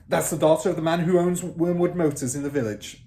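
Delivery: loud, fast barking headmistress; barked. Acted. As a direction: fire it out like a drill sergeant. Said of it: "Miss Trunchbull barked".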